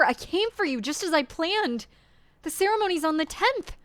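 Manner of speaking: a lover realizing too late, shouting in panic; frantic Jacopo. Acted. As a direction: build from stunned to frantic pleading.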